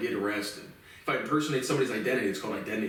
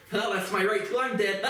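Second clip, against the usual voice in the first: mock stubborn relatives; 7 semitones higher than usual. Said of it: "It was just like".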